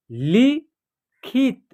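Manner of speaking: लिखित drawn out, syllables apart; slow syllable by syllable. Shown as a demonstration. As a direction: read लिखित slowly in parts. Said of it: "या आप direct भी पढ़ सकते हैं". (लिखित धीरे-धीरे टुकड़ों में)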